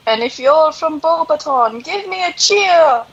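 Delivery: hammed-up crowd hype; announcer's rallying call. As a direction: whip the crowd up like a tournament host.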